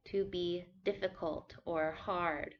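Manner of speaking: usual speaking voice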